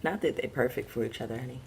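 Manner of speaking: soft, fast, sly aside with a pointed honey; pointed correction. Pretend. To the ex-wife, quietly rewording her line with a pointed honey. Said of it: "Get into the undertones".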